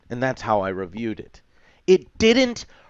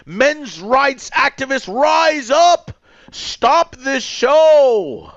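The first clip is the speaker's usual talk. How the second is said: hammed-up outraged shout, much higher and louder; mock rights activist; 8 dB louder than usual. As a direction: parody an angry activist rallying the troops. Mocking anti-woke men's rights rant.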